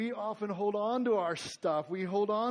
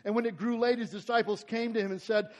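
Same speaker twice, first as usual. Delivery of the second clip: raised, higher reading voice; scripture reading; 5 semitones higher, 8 dB louder than usual. Acted. Pastor reading the passage, raised and ringing. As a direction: proclaim the verse to the room. Preacher reads scripture aloud.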